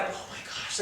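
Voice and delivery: breathless whispered wonder; whispered demo of awe; awed whisper. Shown as a demonstration. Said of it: "wonder and awe".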